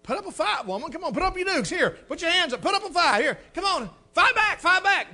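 loud, high taunting; goading challenge. Retold or imitated. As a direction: egg her on to fight back. Taunting wife to fight back.

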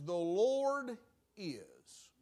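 drawn out, each word weighed; The Lord is, said very slowly with a pause on Lord; savoring "The Lord is" word by word; slow, weighty phrase. Shown as a demonstration. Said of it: "I've been thinking along these lines".

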